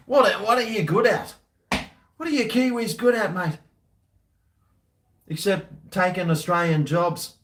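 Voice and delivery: high, laughing mock jeer; teasing taunt. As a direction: needle the Kiwis, mate. Taunting Kiwis over beer.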